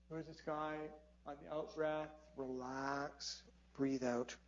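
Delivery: soft, slow relaxation cues; gently pace them through the out-breath; calming breath guide. Real, not acted. Leads slow breathing to de-stress.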